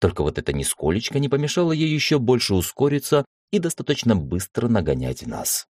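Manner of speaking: plain reading voice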